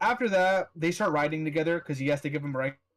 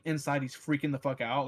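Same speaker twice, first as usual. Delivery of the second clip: normal talking voice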